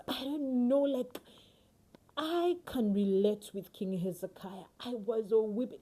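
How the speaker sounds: ordinary delivery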